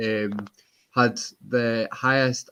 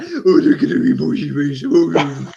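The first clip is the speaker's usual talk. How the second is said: cooing at a dog; 3 semitones higher, 7 dB louder than usual. Retold or imitated. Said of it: "Whereas I'm like".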